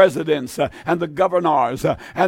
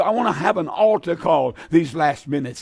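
usual speaking voice

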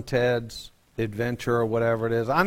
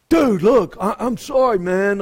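high, louder surfer-dude apology; West Coast airhead voice; 6 semitones higher, 4 dB louder than usual. Retold or imitated. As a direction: play the sheepish surfer dude, pitched up and loud. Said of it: "West Coast airhead talk".

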